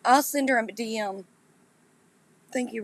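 usual speaking voice

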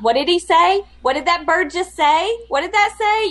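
high, loud baffled questions; baffled tourist straining to hear; confused rider. Retold or imitated. Mimicking lost riders at the pirate game, high and puzzled.

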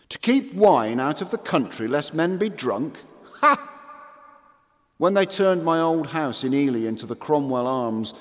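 usual speaking voice

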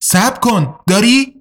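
calls out high and sharp, rising; shouting. A narrator's reading. Hani shouts after the warrior to stop, calling out high. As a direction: stop him before he leaves. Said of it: "هانی داد کشید".

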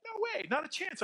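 high mock-defiant child; defiant kid. Imagined son refusing his mother, high cheeky refusal. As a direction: flatly refuse like a cheeky boy. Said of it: "he didn't say that exactly".

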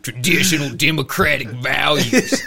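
hammed-up, drawn-out Southern drawl; slow, loud mock-Southern politician; Southern accent. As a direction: lift the pitch and stretch it out Southern-style. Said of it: "I'm giving him a Southern accent".

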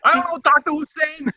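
sidekick voice. Retold or imitated. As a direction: pipe up like Short Round.

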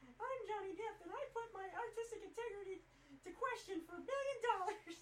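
mocking Mickey Mouse squeak; very high cartoon falsetto; squeaky, self-important parody of a film star; Johnny Depp. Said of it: "You sound like Mickey Mouse".

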